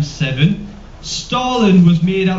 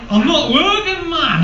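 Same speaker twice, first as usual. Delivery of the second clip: annoyed worker; 9 semitones higher than usual. Retold or imitated. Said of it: "they're like".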